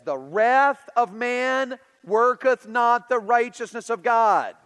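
slow, loud preaching of the verse; scripture quote. Acted. Quoting James to the congregation, slow loud preaching. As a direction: pronounce it with weight. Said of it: "Which is why James says".

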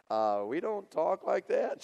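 gently scold, drawing it out; mild correction. Retold or imitated. Family correcting the awkward girl.

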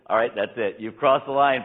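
loud fed-up ultimatum; hammed-up furious dad; angry parent. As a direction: shout the final warning at the kid. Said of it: "And he said".

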